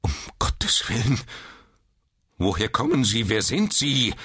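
desperate. A narrator's reading. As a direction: blurt it out in despair. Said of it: "mit verzweifelter Stimme". (mit verzweifelter Stimme herausgeplatzt)

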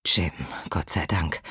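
whispered in relief; relieved whisper. Acted. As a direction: sigh it out under breath. Relieved on phone, unvoiced.

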